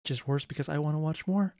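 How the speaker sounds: ordinary talk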